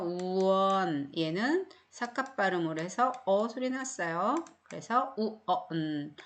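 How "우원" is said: 우원 slow, the oo first then won; teacher showing the w in one; oo before one. Shown as a demonstration. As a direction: start 우원 with a slow oo glide. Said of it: "우 소리를 먼저 내주시면 돼요".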